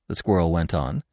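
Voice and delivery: ordinary delivery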